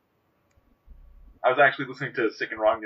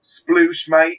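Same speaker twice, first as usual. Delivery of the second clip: loud mock British "Sploosh, mate" called out; hammed-up Cockney-style Brit; British accent; 4 semitones higher, 10 dB louder than usual. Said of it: "I gotta get into character for my British voice".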